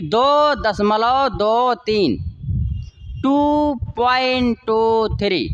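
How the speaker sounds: slow, separated digits "दो तीन" and "टू three". Shown as a demonstration. Teacher dictates how students should write it, slowly. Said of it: "वैसे शब्दों में ... लिखेंगे".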